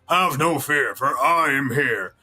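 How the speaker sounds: just deep, slightly slow hero voice; a lightly voiced deep hero; only deep, no throat; deep All Might, no throat. Shown as a demonstration. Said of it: "So instead of".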